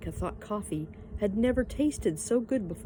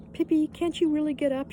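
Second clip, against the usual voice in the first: worried question; 9 semitones higher, 4 dB louder than usual. A narrator's reading. Worried Annika asks.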